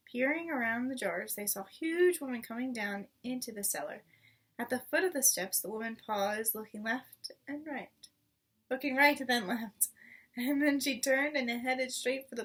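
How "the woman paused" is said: ordinary delivery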